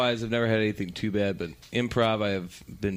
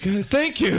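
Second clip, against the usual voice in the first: much higher and louder thank you; relieved host; 10 semitones higher, 4 dB louder than usual. Retold or imitated. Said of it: "He's like".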